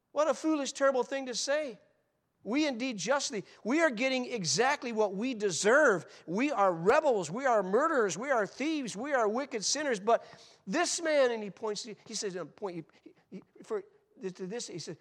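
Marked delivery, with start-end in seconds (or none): 0.1-11.3 loud, high scolding; rebuking thief. Acted. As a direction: scold him hard, hammering each we are.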